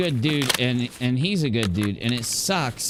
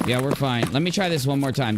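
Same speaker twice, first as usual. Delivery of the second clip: ordinary delivery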